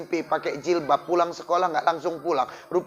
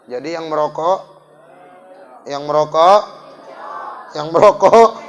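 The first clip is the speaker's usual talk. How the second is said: each "merokok" louder until shouted; building to a shout; 2 semitones lower, 7 dB louder than usual. Acted. Preacher hammering the smokers to his congregation, building to a shout.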